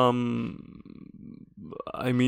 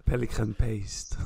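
put-on French accent; 3 semitones lower, 4 dB quieter, slower than usual. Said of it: "do your best French voice".

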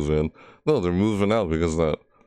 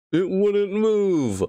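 whiny complaint; 12 semitones higher, much slower than usual. Real, not acted. Complaining after getting caught.

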